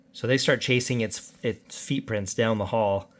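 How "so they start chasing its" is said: ordinary delivery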